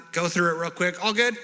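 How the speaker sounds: loud, high, glib wrap-up; breezy dismissal. Acted. Mocking a rushed restoration, breezy and loud. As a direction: toss it off as quickly settled. Mimics rushing through restoration.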